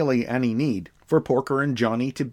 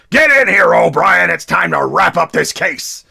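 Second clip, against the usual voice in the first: loud, high gruff police chief yelling into the intercom; shouted order to the officer over the intercom; barked order; 7 semitones higher, 10 dB louder than usual. Retold or imitated. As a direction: bellow it like a cranky old cop. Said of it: "he stabs his finger down on his intercom and goes".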